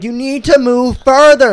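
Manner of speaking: high, loud, stretched-out order; mock demand. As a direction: imitate her bossing a pedestrian. Acting out her demand.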